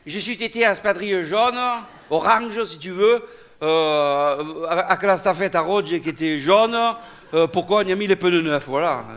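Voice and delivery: southern French accent, grudgingly repeating; southern French accent, saying "stafette" for estafette; own regional French accent. Shown as a demonstration. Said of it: "comme tu parles".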